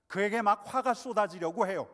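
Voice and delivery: a sudden loud burst of anger; angry outburst. Shown as a demonstration. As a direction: let the anger erupt loudly. Preacher acting out rising anger. (화를 터뜨리듯 크게)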